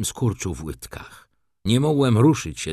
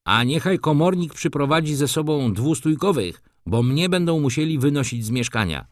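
higher-pitched call after someone; mildly raised, high calling voice; 7 semitones higher, 3 dB louder than usual. A narrator's reading. Young tenant to the departing visitors from the stairs, calling out. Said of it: "wybiegł na schody i zawołał".

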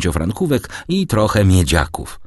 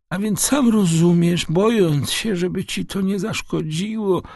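put-on very high-pitched old man's explanation; frail old father, apologetic; 11 semitones higher than usual. Father confessing a paid debt to his son, apologetic. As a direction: plead your case as a feeble, high-voiced father.

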